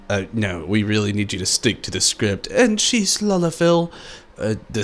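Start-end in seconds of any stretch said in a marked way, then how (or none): 2.5-3.9 high voice. Retold or imitated. Continues princess voice.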